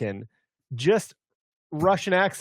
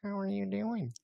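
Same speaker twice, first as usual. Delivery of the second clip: higher, softer attempt at a cartoon voice; half-hearted cartoon impression, pitched up and quiet; Family Guy voice; 6 semitones higher, 10 dB quieter than usual. Host greeting guest Matt in a botched Family Guy voice. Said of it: "like, a Family Guy voice".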